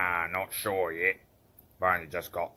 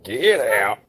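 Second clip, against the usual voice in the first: sharp loud shout; 2 semitones higher, 7 dB louder than usual. Acted. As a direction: yell them away. Shouted dismissal.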